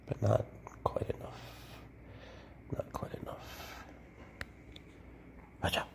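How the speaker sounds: whispered playful taunt; teasing whisper. Real, not acted. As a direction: tease the snake in a hush.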